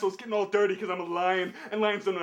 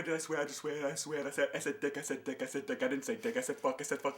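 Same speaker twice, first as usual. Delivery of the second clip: sheepish mumble; 8 semitones lower, 8 dB quieter than usual. Pretend. Mock-sheepish swearing, voice lowered.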